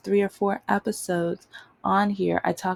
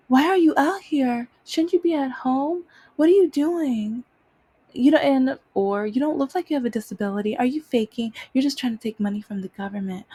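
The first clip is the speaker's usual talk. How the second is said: mocking accuser; 6 semitones higher than usual. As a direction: imitate a nosy accusing stranger.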